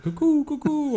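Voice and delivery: high cuckoo call imitation; bird call. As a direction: chirp it like a cuckoo clock. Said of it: "Do you know any bird noises".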